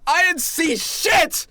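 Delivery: a guard blurting out excuses in a panic; nervous guard shouting his innocence to the party; panicked ranting guard. Acted. Guard panicking, defending himself.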